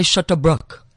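"shut a brook" with both vowels pulled back to uh; both vowels alike, wrong. Shown as a demonstration. Coach mimicking actors' mistake, "shut a brook" with dull back vowels. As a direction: say "shut a brook" as a botched South African "shit a brick". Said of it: "They make both the same, or they say".

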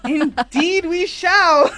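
high, loud mock formal; mock grand. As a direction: play it grandly posh.

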